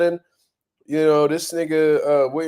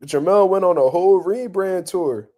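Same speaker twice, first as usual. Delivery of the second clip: ordinary delivery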